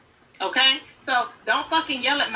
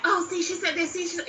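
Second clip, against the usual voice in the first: mocking imitation; 5 semitones higher, 4 dB quieter than usual. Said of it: "But you were like".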